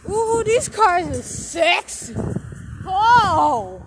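high, loud gushing over the cars; excited gush. Real, not acted. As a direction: swoon loudly at the cars.

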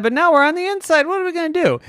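put-on high, fretting child voice; mounting panic. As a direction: work yourself up like a trapped kid. Trapped kids' worry mounts.

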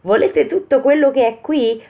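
high, squeaky acted yell; yelling Pollicino. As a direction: cry out in a high little voice, held back in volume. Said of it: "urlare con tutte le forze". (urlo acuto con vocina)

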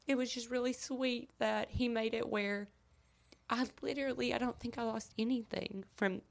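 trying not to cry; holding back tears. Real, not acted. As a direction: voice tight with emotion. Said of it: "Well, I'm trying not to cry".

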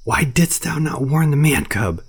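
slow, high angry reproach; roared. Acted. Bagheera blaming Baloo for losing the man cub, slow angry roar. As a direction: lash out at the bear, slowly. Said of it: "he roared".

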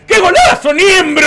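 loud, high celebratory catchphrase; Maurice Clos. Acted. Co-host imitating the ex-governor's catchphrase, high excited yell. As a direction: yell it like an excited goal call. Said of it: "Maurice Clos el que dice".

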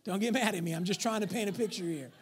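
ordinary talk, nothing marked

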